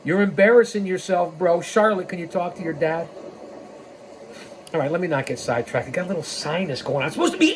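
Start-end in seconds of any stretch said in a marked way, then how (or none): none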